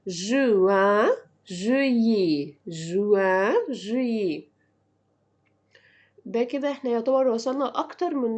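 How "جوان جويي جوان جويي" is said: "جويي" slow, as "zhui-yé" without L; silent L. Shown as a demonstration. Teacher showing silent letters, "جويي" slow with no L. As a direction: draw out "جويي", gliding over the unsounded double L. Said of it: "الاتنين إل ما بينطقوش".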